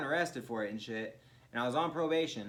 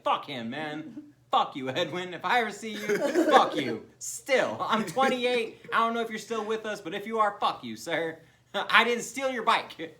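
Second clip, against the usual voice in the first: mock rant; 5 semitones higher, 7 dB louder than usual. Addressing Edwin directly now.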